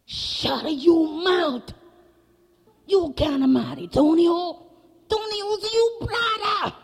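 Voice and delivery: high, slow, loud shout; hammed-up papa yelling in alarm; outraged father. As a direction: forbid it at full volume, pitch way up. Papa angrily forbids the marriage.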